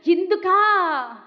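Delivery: slow, drawn-out wail, high and loud; anguished cry. Acted. Preacher voicing Mary at the cross, wailing. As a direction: stretch the word into a mother's cry of despair.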